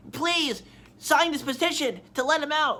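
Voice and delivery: high, pressing plea voiced as Yuki; pleading. Imitates Yuki begging for signatures.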